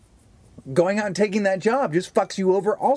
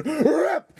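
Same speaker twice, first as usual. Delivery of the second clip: mock rip; 11 semitones higher than usual. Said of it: "me just going".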